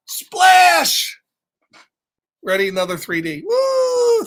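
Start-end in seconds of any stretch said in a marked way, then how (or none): 0.1-1.2 loud high splash cry; splash sound. Acted. Pigeon jumping into the bath, loud high splash cry. 3.5-4.2 excited woo. Real, not acted. Reacting to 3D page.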